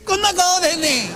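defiant comic retort; cheeky reveal. Retold or imitated. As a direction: show your face with a flourish. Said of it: "わしミス持ち上げて".

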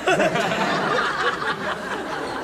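high rising laugh at someone's misfortune; gleeful cackle. Acted. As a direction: master gloating over the fight. Master laughs at the fight.

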